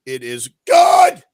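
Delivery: slow, loud shouted decree; mock proclamation. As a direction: bellow it like a pompous sermon. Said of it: "with the appropriate accent".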